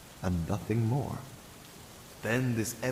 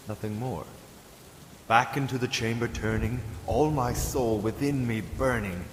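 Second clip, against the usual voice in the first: usual speaking voice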